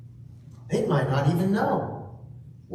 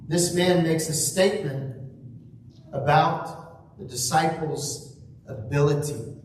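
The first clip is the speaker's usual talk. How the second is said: ordinary talk, nothing marked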